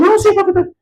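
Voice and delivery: very high squabbling voice; high-pitched mock argument; imitated arguer.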